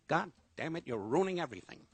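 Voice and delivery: imitating a temper flare; mock angry Don. Don scolding his laughing costar, mild mock anger. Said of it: "he'd get angry".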